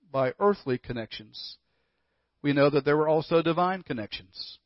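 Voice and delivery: ordinary talk, nothing marked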